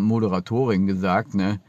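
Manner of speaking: ordinary delivery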